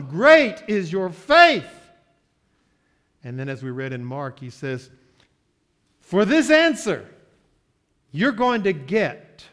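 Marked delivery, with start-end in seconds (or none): 0.0-1.9 P=A; ringing proclamation. Acted. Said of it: "And so he says to her". 6.0-9.5 loud declaration. Acted. Jesus granting the woman's request.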